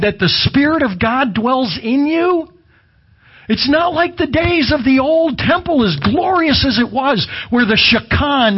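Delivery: high, ringing pulpit voice, pressing each line; preaching at full force. Acted. Preacher stirring the congregation about God's temple, at full force. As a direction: lift the whole room with the temple promise.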